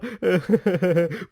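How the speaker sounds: sly crazed giggle; madman's giggle. Acted. Madman teasing about the cave, sly giggle. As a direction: giggle like a madman keeping a secret.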